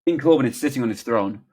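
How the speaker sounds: loud, brisk stage-direction read; script narration. A narrator's reading. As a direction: announce the scene to an audience. Reads a script aloud.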